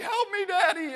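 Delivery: put-on high, loud little-girl plea; imitating pleading child. Daughter begging dad for help, high pleading cry. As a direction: whimper for help like a scared kid.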